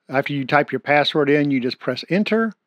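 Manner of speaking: usual speaking voice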